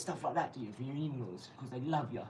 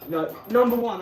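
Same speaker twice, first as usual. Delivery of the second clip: shouted much louder and higher; 9 semitones higher, 15 dB louder than usual. Acted. Taunting a rival on stream.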